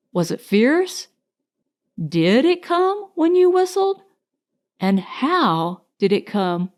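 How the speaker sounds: ordinary delivery, nothing marked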